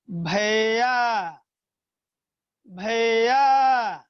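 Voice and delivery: भैया with the final या held very long, calling out; drawn-out call. Shown as a demonstration. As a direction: call भैया and stretch the last syllable या three times as long. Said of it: "last में या का उच्चारण काफी देर तक".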